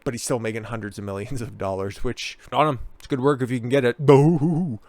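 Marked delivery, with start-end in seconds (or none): 4.0-4.8 loud fake boo-hoo sobbing; mock crying. Host mocking Howard Stern with a fake cry. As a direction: sneer with a fake whiny cry.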